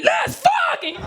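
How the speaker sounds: very high, sudden mock yell; aggressive. Woman jumping out at her ex, aggressive. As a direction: jump out with a hammed-up roar. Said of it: "I would be aggressive".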